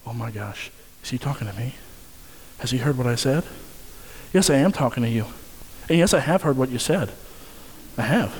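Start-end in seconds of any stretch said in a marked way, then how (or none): 0.0-3.4 softer, lower nervous inner voice; hushed mock alarm, wondering if he means them; mock guilty panic. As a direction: voice a listener who just got caught. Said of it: "you feel like".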